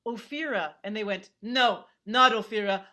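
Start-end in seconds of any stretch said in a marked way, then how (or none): 1.4-2.8 stern correction. Retold or imitated. Stranger correcting her, acted.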